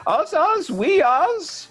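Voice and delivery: a wild reveller heralding the day; a high, hammy voice for the costumed general; eccentric general. Acted. Revellers chant in poem.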